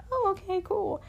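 high bright mock enthusiasm; acting breezy though unsure; chirpy fake-cool agreement. To the guy about the woods fire, high chirpy okay.

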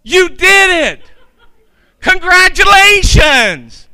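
high, loud, slow overjoyed elf shout; excited Buddy the Elf yell. Retold or imitated. Buddy cheering the diner staff, high excited shout. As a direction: yell it like a thrilled, childlike elf. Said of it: "he yells".

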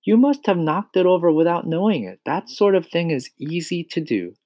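high-pitched teacher voice; Miss Honey explaining. A narrator's reading. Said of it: "she said".

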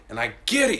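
ordinary talk, nothing marked

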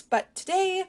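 usual speaking voice

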